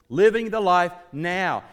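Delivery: "Living the life now" loud and high, falling at the end; "Living the life now" proclaimed forcefully to the room; emphatic repetition. Real, not acted. Preacher repeats key phrase emphatically.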